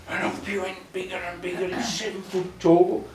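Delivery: ordinary delivery, nothing marked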